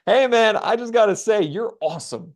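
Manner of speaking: suddenly chummy, high and loud start falling away; officer breaking character. Retold or imitated. Officer to the traveler after an hour, suddenly chummy. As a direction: switch to casual, friendly enthusiasm. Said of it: "breaks character, completely different person, one eighty in personality".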